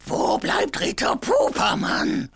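high, slow call of a king. Acted. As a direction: call out across the hall. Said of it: "rief der König".

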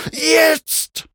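high, loud, long-held scream. A narrator's reading. Mount screams a third time while racing down, straining scream. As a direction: hold it out as a high battle cry. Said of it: "schrie Mac".

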